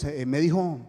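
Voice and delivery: usual speaking voice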